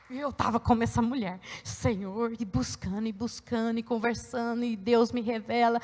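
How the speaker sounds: low, soft recounting after a climax; hushed testimony. Real, not acted. Preacher confiding her own searching to listeners, hushed and low. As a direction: drop right down, intimate and prayerful.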